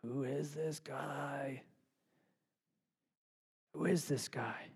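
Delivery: slow, hushed, then whispered; awed disciples. Acted. Disciples stunned after the storm, whispering in awe. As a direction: marvel in a hushed voice. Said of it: "the disciples look at each other and be like".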